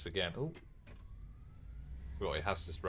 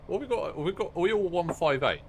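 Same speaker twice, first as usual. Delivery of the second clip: high, louder eager question; excited; 7 semitones higher, 5 dB louder than usual. Real, not acted. Train fan spotting other trains, excited. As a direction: perk up at the sight.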